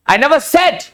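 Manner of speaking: "said" lifted high at the end; stress on SAID. Shown as a demonstration. Teacher explaining it was not spoken aloud, stress on "said". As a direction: land hard on "said". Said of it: "मैंने कहा तो नहीं".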